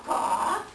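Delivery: a sharp breathy high caw. Acted. Raven caws back after the elder's orders. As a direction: give a quick high caw.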